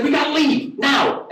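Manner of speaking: high, punchy, urgent order; urgent panic. Retold or imitated. Joe says they must flee, high and panicky. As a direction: push it out in mock alarm.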